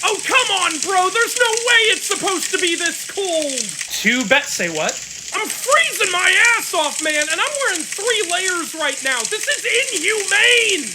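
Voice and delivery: high, whiny freezing complaint. Acted. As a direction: whine like you're freezing.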